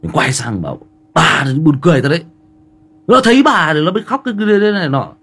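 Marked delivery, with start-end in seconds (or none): 1.1-5.1 high, loud, fast scolding; loud acted outburst, pitched way up; retold angry yelling. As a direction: re-enact yelling at mum in a rapid high-pitched burst. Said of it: "mình quát um tí tỏi luôn".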